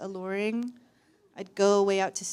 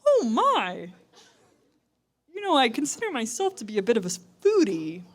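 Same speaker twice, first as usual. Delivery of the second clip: hammed-up foodie, swooping high; coy officer; 8 semitones higher, 4 dB louder than usual. Security officer angling for a taste, high and coy. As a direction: wheedle coyly, climbing much higher.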